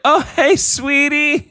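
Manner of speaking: high-pitched, awkward sweetness; sweet talk to toddler. Retold or imitated. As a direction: coo politely at someone else's little kid. Adult reacting nicely to toddler.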